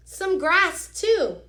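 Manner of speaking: put-on bright, high and loud child's request; a kid excitedly adding an idea; eager little girl. Reading to the class, teacher voices Amanda eagerly asking for grass.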